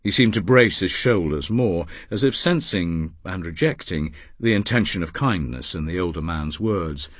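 plain reading voice

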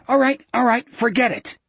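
high, hurried, whiny surrender; breathy near-whine. A narrator's reading. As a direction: back down in a scared, high-pitched rush. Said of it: "breathy rush that was close to a whine".

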